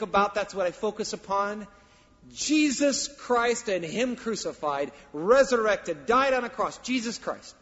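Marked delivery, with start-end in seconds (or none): 2.3-7.5 loud, slow, rising preacher's cry; preaching at full force. Acted. Pastor proclaiming Christ to the congregation, loud and ringing. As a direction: proclaim it to the whole church.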